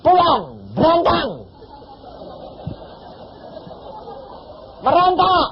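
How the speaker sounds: high, loud, emphatic orders; loud instruction. Retold or imitated. Preacher ordering the pregnant woman to crawl home, loud and emphatic. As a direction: bark out the odd instructions.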